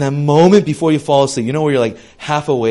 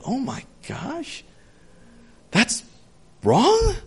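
breathy gasp, then a much higher wrong; shocked gasp; 8 semitones higher, 6 dB quieter, slower than usual. Retold or imitated. A kid reacting to a TV dad, shocked. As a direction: act out a kid realizing something is off. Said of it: "being like".

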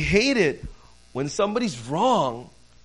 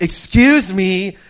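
much louder, drawn-out, raised "excuse me"; insistent interruption; 4 semitones higher, 12 dB louder, much slower than usual. Retold or imitated. Employee told to wait interrupts the player, loudly insistent. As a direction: stretch it out to demand attention.

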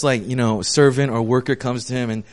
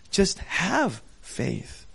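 soft and very slow, lingering on each word; gentle slow reassurance; 2 semitones higher, 8 dB quieter, much slower than usual. Retold or imitated. Jesus gently comforting the frightened father, softly and slowly. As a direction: ease his fear gently.

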